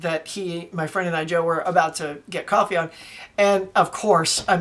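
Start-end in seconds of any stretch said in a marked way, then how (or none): none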